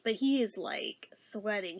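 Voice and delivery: hushed, breathless whisper; soft whispered aside. Real, not acted. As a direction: drop to a whisper for the sweat. Describing nervous passenger.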